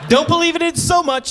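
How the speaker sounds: hyped-up aside; excited. Retold or imitated. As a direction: pump it up high.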